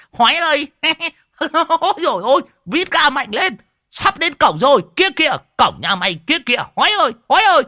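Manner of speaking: high-pitched cackling egging-on; gleeful cheering yell. A narrator's reading. Old man cheers on his runaway friend, yelling gleefully. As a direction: whoop and jeer him on with laughter. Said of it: "gào lên cổ vũ".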